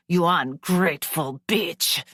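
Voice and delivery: a father's vicious snarl; snarled insult. Acted. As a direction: snarl it at her. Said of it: "he snarled".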